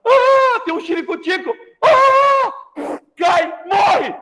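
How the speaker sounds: high wailing cry that ends in a shout; hammed-up death scream, crying then yelling; dying cry. Joana the maid collapsing from a heart attack, hammed-up wailing scream. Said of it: "Aí Joana falou".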